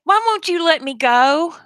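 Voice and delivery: high, slow mock plea to Dad; imitating pleading daughters. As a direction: draw it out like a kid begging Dad. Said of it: "they'd be like".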